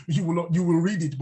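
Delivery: usual speaking voice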